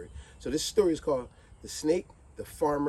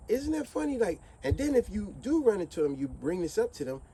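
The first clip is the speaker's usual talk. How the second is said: normal talking voice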